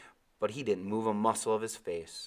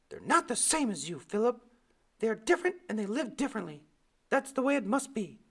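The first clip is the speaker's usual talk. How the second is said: much higher prim mother's voice. Retold or imitated. Mother warning Philip off the schooners, prim and high. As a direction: lecture like a stern mother. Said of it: "She'd say".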